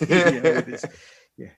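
usual speaking voice

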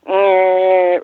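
long, level droning motor noise; motor whir imitation. Friend describing an overstuffed Cadillac trunk, imitated motor drone.

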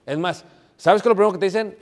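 fired-up preacher challenge; emphatic preaching shout. Real, not acted. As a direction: hit the congregation with it. Preacher punches rhetorical question.

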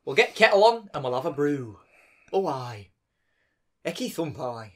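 mock Yorkshire accent; northern English accent. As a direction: put on a homely Yorkshire dialect. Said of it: "It's definitely up north somewhere".

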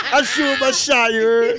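laughing shout, accent kept. Real, not acted. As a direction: yell it while cracking up.

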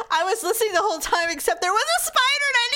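high, rushed shriek over a spider; real screaming panic. Real, not acted. Host explains the spider she had to kill, screaming panic. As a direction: blurt it out fast, voice shooting up. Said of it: "I heard screaming".

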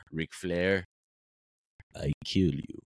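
low, halting giant's wrestling promo; Giant Gonzalez promo. Retold or imitated. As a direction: growl it out slowly in broken English. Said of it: "cut a promo on Ric Flair".

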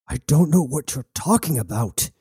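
lightly acted, baffled denial; bewildered protest. Bound prisoner tells his captors he knows nothing, protesting. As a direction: protest it with a rising jump in pitch.

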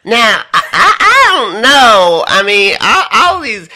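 high, loud, slowed put-on voice with a laugh; Chris Hills impression. Guest shows off his friend's voice to the host, high and loud. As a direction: crank it up high and brag. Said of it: "the best Chris Hills impression".

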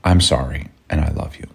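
soft, low, earnest apology; calm it down gently; tender. Retold or imitated. Wife grabbing husband's shoulders mid-spat, tender apology. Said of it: "you kinda took the high road".

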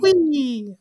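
high, loud gleeful Mario squeal; Mario's "Wee!". Retold or imitated. Listing Mario's video game catchphrases, gleeful high squeal. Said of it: "we get the catchphrases".

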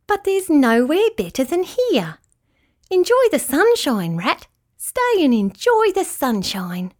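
contented storybook rabbit; persuasive rabbit. A narrator's reading. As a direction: coax a friend to stay and enjoy it. Rabbit urges Rat to stay.

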